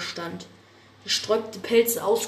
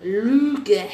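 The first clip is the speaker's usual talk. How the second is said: a loud, spat-out single word; hissed retort; 9 dB louder than usual. Acted. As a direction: spit the accusation back.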